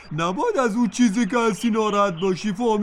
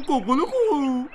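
rooster crow; 3 semitones higher than usual. Acted. Rooster crows.

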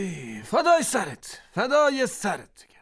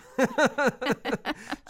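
soft laugh; 3 dB quieter than usual. Acted. Wife laughs again.

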